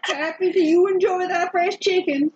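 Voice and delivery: high, laughing mock cheer; laughing reply. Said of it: "and I'm like".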